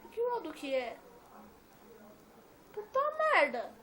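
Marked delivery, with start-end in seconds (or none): 2.7-3.7 sudden high, loud curse; startled outburst. Real, not acted. Gamer cursing in shock at a sudden attack, loud and high.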